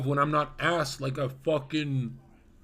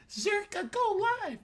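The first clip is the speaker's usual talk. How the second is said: much higher, shrill demanding whine; caps lock shout. Retold or imitated. Mocking a viewer typing in caps lock to demand a stream. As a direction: screech it like an all-caps chat message. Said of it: "If you say it like".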